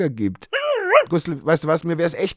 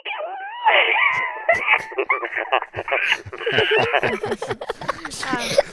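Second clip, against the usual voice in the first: squealing laugh. Real, not acted. Laughs at helium voice.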